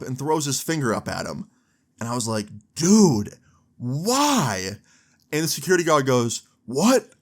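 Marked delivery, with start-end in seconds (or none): 2.7-4.8 higher, louder exasperated cry; exasperated disbelief. Retold or imitated. Teller to friend who insulted the guard, exasperated. 6.7-7.1 high, loud, affronted outburst; mock an outraged guard; retold angry shout. Insulted security guard reacting to the friend, real mad. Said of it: "he gets real mad".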